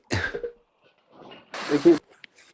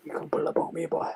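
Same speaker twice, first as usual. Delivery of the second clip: whisper; 4 semitones higher, 7 dB quieter than usual. Acted. Teasing whisper to Joker.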